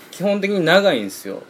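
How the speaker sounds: ordinary talk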